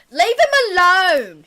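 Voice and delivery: loud, high, slow scolding shout; barmaid shouting. Acted. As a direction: yell at them to back off.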